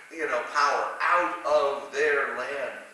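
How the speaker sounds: ordinary talk, nothing marked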